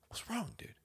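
put-on hushed concern for a crying kid; whisper. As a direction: gently whisper into the dark room.

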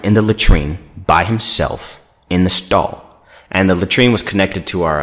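ordinary talk

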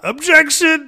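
high, loud mock courtroom shout; hammed-up loud objection; mock yelled objection. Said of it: "I wanted to yell out".

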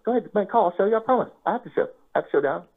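eager, high-voiced assurance; high-pitched coaxing. Player promising a doubter he'll show, high-pitched coaxing. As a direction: coax him along in a high, harmless voice. Urging opponent to call, retold.

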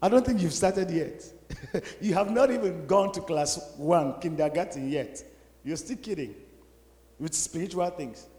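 soft, gently amused correction; a quiet, half-laughing rebuke; quiet reply. Retold or imitated. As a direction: say it softly with a chuckle. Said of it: "I was so quiet".

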